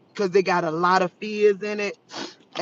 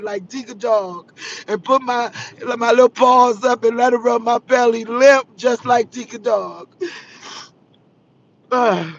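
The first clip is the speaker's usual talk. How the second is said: ordinary delivery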